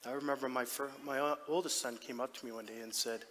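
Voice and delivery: ordinary talk, nothing marked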